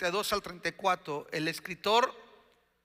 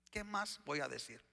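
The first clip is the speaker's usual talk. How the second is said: low, much softer rhetorical question; quiet question; 3 semitones lower, 12 dB quieter than usual. Acted. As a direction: let the question drop to a soft murmur. Same question repeated low and quiet. (pregunta en voz baja, apagada)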